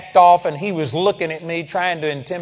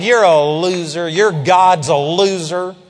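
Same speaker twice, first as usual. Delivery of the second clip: laying it on; 5 dB louder, slower than usual. Retold or imitated. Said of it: "I started laying it on him".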